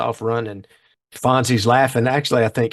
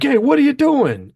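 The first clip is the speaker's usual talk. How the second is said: hoodlum yelling; 5 dB louder than usual. Retold or imitated. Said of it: "the guy's like".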